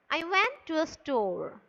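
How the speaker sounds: a statement that falls away on "store"; falling intonation. Shown as a demonstration. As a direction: let STORE drop in pitch. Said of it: "the voice is falling".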